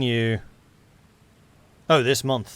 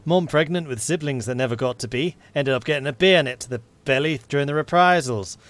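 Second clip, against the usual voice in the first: earnest attempt at a Yorkshire accent; animated Yorkshire military policeman. MP explaining he joined for his wife, Yorkshire accent. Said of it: "Is that my best Yorkshire accent?".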